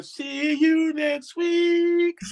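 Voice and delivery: very slow, high sing-song sign-off; mock sitcom sign-off, high and slow; catchphrase impression. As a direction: draw it out high and slow like a TV closer. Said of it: "And then you hear".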